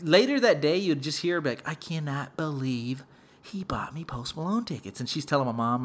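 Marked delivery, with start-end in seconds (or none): 1.7-5.0 hushed imitation of lingering disbelief; amazed whisper. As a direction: whisper it to yourself in wonder. Sister muttering in amazement later.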